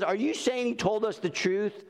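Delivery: a touch of stunned disbelief. Acted. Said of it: "And then he says".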